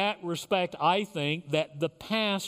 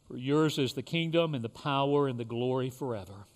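leading the Lord's Prayer with long pauses; short phrases with pauses; 6 semitones lower than usual. Real, not acted. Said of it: "I will say a phrase".

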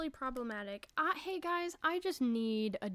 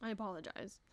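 normal talking voice

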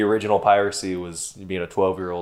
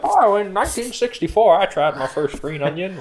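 mock old-timer voice; 10 semitones higher than usual. Mocking an old listener's email.

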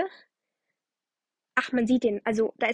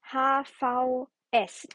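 langsam buchstabiert; much slower than usual. Shown as a demonstration. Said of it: "Wir nennen sie die".

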